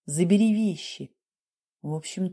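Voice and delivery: usual speaking voice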